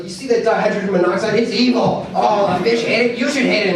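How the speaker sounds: high, loud rallying pep talk; inspirational speech. Retold or imitated. Counselor hyping up twelve-year-old boys, loud rally speech. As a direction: rouse the troops against the water. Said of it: "giving them an inspirational speech".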